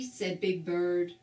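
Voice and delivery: ordinary delivery, nothing marked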